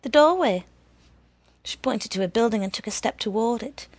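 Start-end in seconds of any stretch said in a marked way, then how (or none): 0.0-0.7 firm loud command. A narrator's reading. Woman directing the boy.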